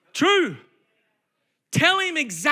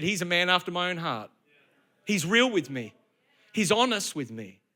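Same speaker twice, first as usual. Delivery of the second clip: low, softened quoted voice of God; quiet approval; 7 semitones lower, 5 dB quieter than usual. Acted. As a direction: say it low and soft, as God vouching for someone. God's approval of David voiced.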